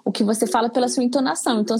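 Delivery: ordinary talk, nothing marked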